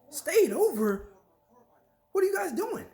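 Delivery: high, loud incredulous yelp; shocked disbelief. Retold or imitated. Viewer scandalised by the sleepover news, high-pitched shock. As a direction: gasp it out, scandalised. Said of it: "reacting in disbelief".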